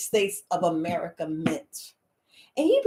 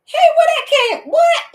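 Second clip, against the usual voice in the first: high, loud shocked cry of disbelief; shocked surprise; 8 dB louder than usual. Retold or imitated. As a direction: shriek it like you just saw something unbelievable. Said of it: "you be like".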